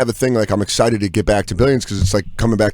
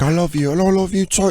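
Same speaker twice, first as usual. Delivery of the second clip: mock British dad, pitched up; British accent; 7 semitones higher than usual. Actor talking to his kids on the phone, British accent. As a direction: imitate a British father's tender phone voice. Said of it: "he does his regular voice with his kids".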